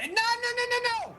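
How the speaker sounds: high, frantic protest; juggler in alarm. Acted. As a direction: shout him down in a panic. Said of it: "he sees you start".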